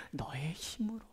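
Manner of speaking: soft unvoiced whisper; whispered answer. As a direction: answer the cry in a hush. Answered in whisper after shout. (속삭이듯 조용한 대답)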